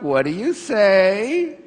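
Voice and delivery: slow, drawn-out mother's reminder; a hint of a patient mother nudging; patient mom prompt. Mom reminding her child at a party to give thanks. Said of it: "she's like".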